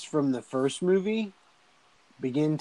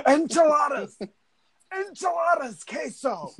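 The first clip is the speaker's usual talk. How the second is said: very high-pitched comic yelp; mock battle cry; 14 semitones higher than usual. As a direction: squeal it like a silly war cry. Retold fighter yelling food names.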